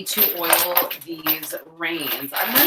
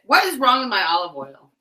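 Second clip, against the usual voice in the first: louder, playfully offended challenge; mock indignant; 3 semitones higher, 6 dB louder than usual. Real, not acted. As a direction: demand it as if slighted. Mock indignation, defending olive oil.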